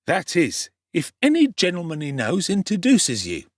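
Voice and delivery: a hint of a sly young pickpocket; cocky street boy. The Dodger promising Oliver lodgings, lightly voiced urchin.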